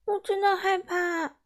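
high, slow, near-tearful; almost crying with fright; scared girl close to tears; small trembling voice. Acted. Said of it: "用细小发抖的声音说".

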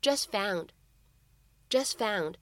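"just found" with the t of "just" held, not released; incomplete plosion. Shown as a demonstration. Teacher demonstrating "just found" for students, t unreleased. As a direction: say "just found" slowly, swallowing the final t. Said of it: "此时我们可以读成".

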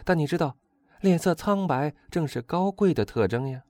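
high, animated, protesting friend; incredulous Albert. A narrator's reading.

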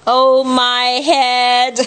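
slow, high mock groan; droning, held storybook moan; Zig's groan. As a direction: stretch it out as a bumped character. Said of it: "groaned Zig".

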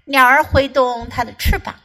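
ordinary talk, nothing marked

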